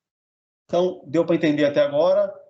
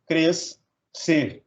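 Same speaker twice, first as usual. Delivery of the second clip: "cres" and "ser" with a clear gap; split into syllables. Shown as a demonstration. Teacher dividing crescer, "cres, ser" syllable by syllable. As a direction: say "cres", pause, then "ser". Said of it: "separar um em cada sílaba".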